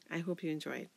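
plain reading voice

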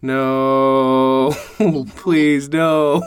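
drawn-out, high mock plea; mock pleading. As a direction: plead in exaggerated, long-held words. Mock pleading at gross story.

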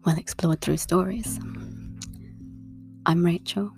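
usual speaking voice

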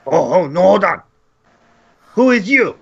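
high, broken-grammar goblin. Acted. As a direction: squeak it out like a nervous goblin. DM voices goblin leader.

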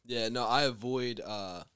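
ordinary talk

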